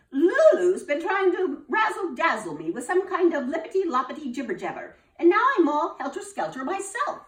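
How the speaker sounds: high, loud and frazzled; flustered teacher. Acted. Missus Bell complains to the principal, high and flustered. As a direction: she can't keep her own words straight.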